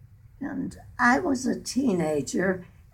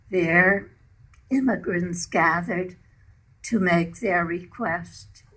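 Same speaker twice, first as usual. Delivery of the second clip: poem reading; 3 dB louder than usual. Acted. Poem narration continues.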